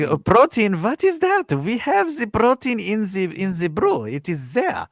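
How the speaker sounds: put-on German accent. Podcaster impersonating his protein-baffled German-built friend, thick German accent. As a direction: do a silly, high-pitched mock German accent. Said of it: "my worst German accent ever".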